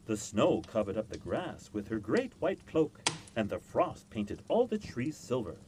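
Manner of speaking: ordinary delivery, nothing marked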